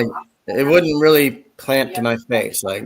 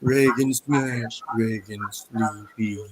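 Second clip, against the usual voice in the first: slow zombie voice, fading softer; Ronald Reagan; 6 dB quieter, slower than usual. Retold or imitated. Cartoon zombie president tiring himself out, slow fading zombie voice. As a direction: drag it out, tiring as it goes. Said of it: "he was like a zombie".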